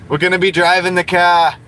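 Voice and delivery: hammed-up Boston accent, R dropped. Streamer extending his friend's catchphrase for chat, Boston accent.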